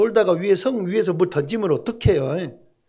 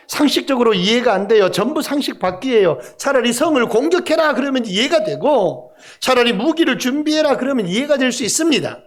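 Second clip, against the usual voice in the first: normal talking voice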